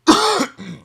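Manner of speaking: sharp real cough; a sudden hacking cough. Real, not acted. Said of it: "Excuse me".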